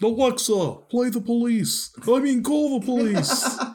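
high, loud caricature voice; mock character. Playing Frank commanding a smart speaker, high loud caricature. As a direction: order the gadget around, high and silly.

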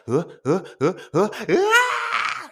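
high, loud imitated sobbing gasps rising to a wail; hammed-up kid crying, breaking into a loud high cry; slow, high mock sob turning into a wail. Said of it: "And she started crying".

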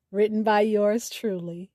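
ordinary talk, nothing marked

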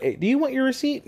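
slightly high, quick cashier question; a hint of a chirpy clerk; light cashier voice.

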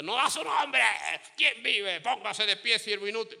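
high, loud bark of an order; harsh greeting. Retold or imitated. Servant greeting the congregation wrongly, loud and harsh. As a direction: greet them like a drill sergeant. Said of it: "No, no, no, no".

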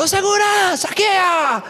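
high shouted call for the guard, in a retold scene; yelling for security. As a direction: holler across the mall for security.

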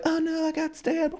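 high, soft, slow and deadpan; mock stabbed hero. Retelling Tangled's ending to friends, a high, limp mock cry. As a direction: play the dying hero totally unbothered. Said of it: "And then he's like".

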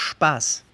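"Spaß" starting with a sh sound, shpahs; S como sh. Shown as a demonstration. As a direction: say "Spaß" as German "Shpaas". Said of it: "la S cambia su pronunciación a sh". ("Spaß" pronunciado "shpaas")

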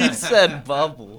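loud, high laughing words; laughing hard. Real, not acted. Listener mocking the host's mispronounced name, laughing hard. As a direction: crack up while repeating the slip.

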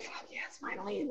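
whispered inner cheer; hushed, voiceless aside; relieved whisper. Retold or imitated. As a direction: whisper it as a secret little victory. Retold private relief at driving.

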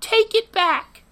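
slow, squeaky high Take it back; high falsetto. Pretend. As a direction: demand it in a drawn-out falsetto. Falsetto demand, then plain repeat.

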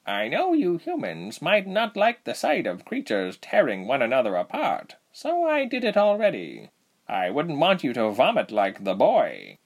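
lightly voiced matter-of-fact hunter; Saki's voice. A narrator's reading. Saki voiced by narrator.

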